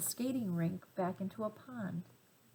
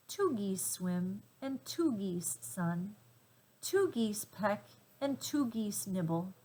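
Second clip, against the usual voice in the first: plain reading voice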